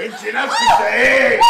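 very high, loud outburst; outraged shout. Acted. Husband outraged by his wife's excuse, shouting. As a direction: explode at the answer.

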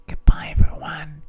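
close-mic whisper right in the ear. Acted. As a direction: breathe the goodbye into the mic. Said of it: "I'm going to whisper in my microphone".

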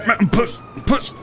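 high, urgent push to breathe; urgent coaching. Acted. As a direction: coax him back to life. Urging collapsed man to breathe.

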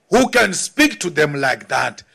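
normal talking voice

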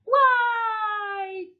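long high wail; high drawn-out cry. Acted. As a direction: cry out high, holding the vowel. Cry amid a crash scene.

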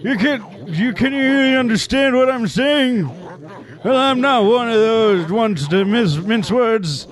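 put-on high, quirky old elder voice; old man voice. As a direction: play a kooky village elder. Village elder read aloud.

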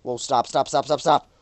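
ordinary talk

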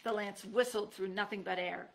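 ordinary delivery, nothing marked

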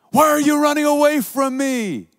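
high shouted name, calling after him; mock desperate yell. Woman calling after her ex, high desperate yell. Preacher voices screaming woman.

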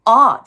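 loud, long, wide-open あー; open-throat vowel. Shown as a demonstration. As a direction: open the throat and belt a clear あー. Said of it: "喉を縦に開く". (喉を縦に開いて大きく「あー」)

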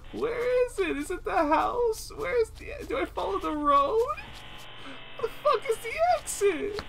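very high, tearful-sounding whine; wailing. Real, not acted. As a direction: wail in despair at still being lost. Lost, cannot find the exit.

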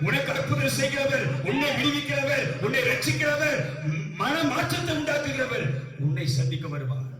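climbing preacher's litany; emphatic preaching. Real, not acted. Preacher promising the congregation their healer is coming, emphatic preaching.

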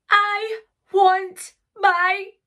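high, slow wail of demand; crying out. A narrator's reading. Sarah demanding her bear at last, crying out. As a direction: draw out each word, building to a long cry. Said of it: "cried Sarah".

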